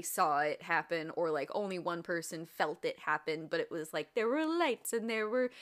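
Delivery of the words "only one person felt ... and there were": normal talking voice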